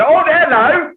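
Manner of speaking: loud, very high Geordie greeting; exaggerated Geordie shriek; northern English accent. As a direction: squeal it in hammed-up northern English. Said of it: "spent a bunch of time in the north".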